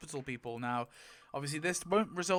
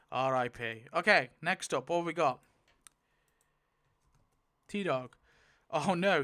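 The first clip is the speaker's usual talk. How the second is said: normal talking voice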